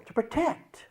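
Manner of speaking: high, small voice; high-voiced answer. Part telling counselor what the sleepiness is for, high-voiced.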